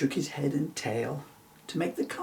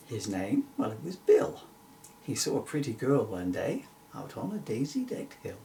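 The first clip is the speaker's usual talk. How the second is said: plain reading voice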